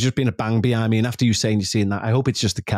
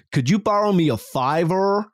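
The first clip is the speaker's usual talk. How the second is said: imitated American accent; 6 semitones higher than usual. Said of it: "who was American who used to say".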